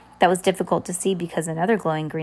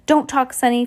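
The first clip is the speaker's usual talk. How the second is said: raised, higher calling voice; 6 semitones higher, 5 dB louder than usual. A narrator's reading. Fiona calls through the helmet window to Sunny, calling out. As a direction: call a warning through a small window. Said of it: "Fiona called through the tiny window".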